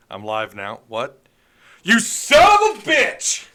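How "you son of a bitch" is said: high, loud, slow hammed-up cry of betrayal; mock outraged yell. Streamer fake-raging at Logan as the stream goes live, hammed-up yell. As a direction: bellow it like a wronged soap-opera hero.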